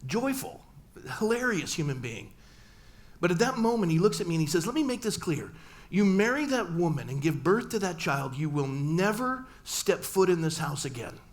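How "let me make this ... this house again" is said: stern ultimatum. Retold or imitated. Father banishing son over marriage, loud and stern. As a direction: lay down the law to your son. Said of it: "this is so not like my father".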